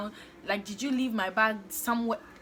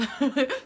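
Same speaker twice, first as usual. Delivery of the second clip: brief real laugh; amused little laugh; short laugh; 5 semitones higher, 6 dB louder than usual. Real, not acted. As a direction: let a quick laugh slip out. Laughs at her own reply.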